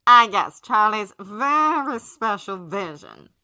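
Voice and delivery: slow, loud, dripping with sarcasm; sardonic. A narrator's reading. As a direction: drawl it with scorn. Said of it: "Alistair says sardonically".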